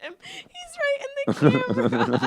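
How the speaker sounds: high laughing words. Real, not acted. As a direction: laugh through it at the dog. Laughing at dog.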